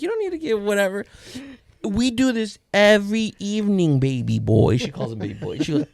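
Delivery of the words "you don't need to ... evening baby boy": put-on raised, emphatic child voice; imitated big sister. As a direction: scold a baby gently as his sister. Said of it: "She was like".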